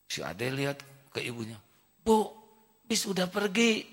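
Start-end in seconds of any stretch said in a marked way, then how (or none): 2.0-3.9 put-on high child telling mother; child's voice. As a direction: tell mum like a small child. (suara anak kecil kepada ibunya)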